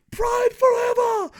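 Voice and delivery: loud, very high battle cry; yelling. Acted. Nostalgic reviewer cheering for old Pride, yelling. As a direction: yell it high in one drawn-out burst. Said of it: "me yelling the word forever".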